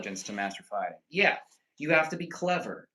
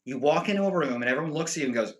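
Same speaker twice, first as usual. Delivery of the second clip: ordinary talk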